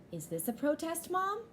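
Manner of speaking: put-on high, young child voice asking; child's question. Samantha asks her mom about the march photo, in a high childlike voice. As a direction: voice her as a curious little girl.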